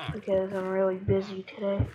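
usual speaking voice

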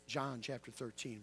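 usual speaking voice

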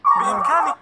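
loud, high squealed I don't know; hammed-up squeal; mock shriek. Sudden loud squeal of confusion.

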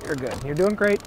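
ordinary delivery

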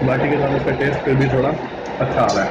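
talking while chewing; mouth partly full. Real, not acted. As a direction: food in mouth. Seen 0: Put food in mouth at -4; jaw working while he talks.

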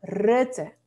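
'Rutte' with an eh-like first vowel; isolated 'Rutte', short front vowel; correct short vowel. Shown as a demonstration. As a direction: model 'Rutte' like 'Rette'. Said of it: "porque tenemos doble consonante".

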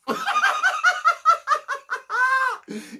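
a squealing burst of laughter; high shrieking laugh. Real, not acted. Laughing at own skit.

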